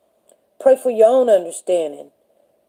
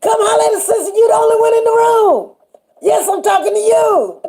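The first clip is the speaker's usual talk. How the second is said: the Lord insisting he means this viewer; high mock Lord voice; 10 semitones higher than usual. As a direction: squeal it out as a teasing Lord. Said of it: "He be like".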